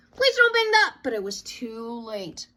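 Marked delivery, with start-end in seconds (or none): 0.1-1.0 much higher, louder plea; cried out. A narrator's reading. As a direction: call out in alarm. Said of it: "Brother cried".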